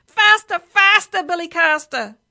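high, loud, repeated urging; screaming parrot. A narrator's reading. Parrot swinging on the driver's earring, screaming. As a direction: scream him on, wild for speed. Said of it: "it screamed in his ear".

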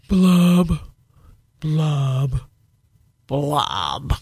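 slow sad fish bubbles; underwater blub blub. Acted. Fish ends its refrain.

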